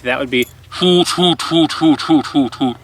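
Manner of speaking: spoken hoot, hoot, clipped and falling; saying hoot. Shown as a demonstration. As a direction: say hoot hoot in short falling bursts. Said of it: "I'm not just saying hoot".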